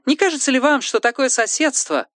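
high, thin put-on voice; high character voice.

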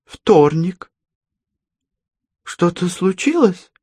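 a flustered grandma, mild; grandmother voice. Acted.

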